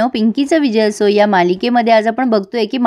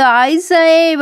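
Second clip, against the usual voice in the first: mock wailing; 5 semitones higher, much slower than usual. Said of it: "ती रडायला लागते मोठ्याने".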